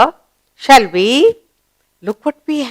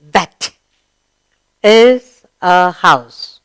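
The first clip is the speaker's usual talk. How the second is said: very slow, word by word; slow read-along; 4 semitones lower, much slower than usual. Shown as a demonstration. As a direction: lead the class through it slowly. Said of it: "Read this with, with me".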